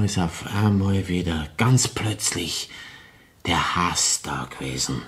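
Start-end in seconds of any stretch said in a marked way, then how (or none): 3.5-5.0 tonloses Flüstern, dunkel; geflüstert. Acted. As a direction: den Hass fast lautlos hauchen. Mörder gesteht seinen Hass. (leise geflüstert)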